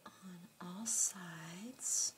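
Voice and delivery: whisper. Real, not acted. Slow unvoiced aside.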